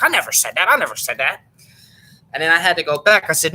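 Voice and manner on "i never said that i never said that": mock high defensive denial, louder; indignant denial. Vlogger imitating Lucas denying the quote, raised defensive voice. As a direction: play him protesting he never said it.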